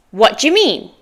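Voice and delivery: "What do you mean?" as quick "whaddya mean"; native linked form. Shown as a demonstration. Teacher models before the learner's turn, linked form. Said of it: "Your turn".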